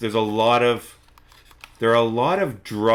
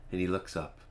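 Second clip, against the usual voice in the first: ordinary delivery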